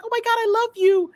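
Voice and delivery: high, loud mock fangirl gushing; imitated fan scream. Screaming fan to the Flash, imitated squeal. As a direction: squeal adoringly, well above your own pitch. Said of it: "someone screams off screen saying".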